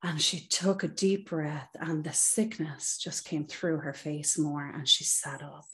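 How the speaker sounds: ordinary talk, nothing marked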